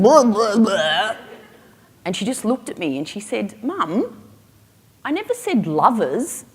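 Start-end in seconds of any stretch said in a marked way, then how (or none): 0.0-1.2 loud blahs standing in for a lecture; mock parental rant; mock scolding. Acted parental telling-off trails into nonsense. 3.6-6.4 high-pitched imitated daughter correcting mum; mock indignant kid voice; exasperated child. Daughter correcting her mother, acted.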